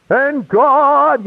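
high, swooping mock-preacher intonation; fervent preacher. Showing how the preacher spoke so weird, mock preacher fluctuations. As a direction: imitate an old-fashioned preacher with exaggerated pitch fluctuations. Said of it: "you know, with the fluctuations".

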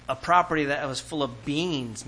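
ordinary talk, nothing marked

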